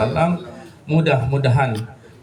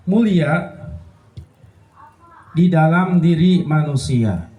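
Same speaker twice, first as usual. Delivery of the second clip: fiery preaching; 7 semitones higher than usual. Acted. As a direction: preach it out to the congregation. Preaching at full force.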